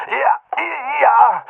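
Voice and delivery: high, loud, sobbing plea; sobbed yes. A narrator's reading. Beaten boy sobs his answer to the attacker, sobbing. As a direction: cry out the yes in panic. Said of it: "schluchzte ich".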